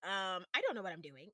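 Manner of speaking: ordinary talk, nothing marked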